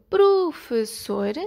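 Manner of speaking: "professor" split into slow separate syllables; "professor" broken up evenly for counting. Shown as a demonstration. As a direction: sound out "professor" in beats. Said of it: "O exemplo".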